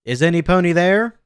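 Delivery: loud questioning shout into the next room; loud call. A narrator's reading. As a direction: call out loudly to whoever might be there. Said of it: "I said loudly".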